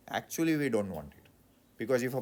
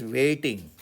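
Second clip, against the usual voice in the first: ordinary talk, nothing marked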